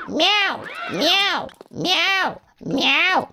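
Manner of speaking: high-pitched cat meows; imitated cat cry; kitty meowing. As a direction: mew like a kitty, high and repeated. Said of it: "you sound like a kitty".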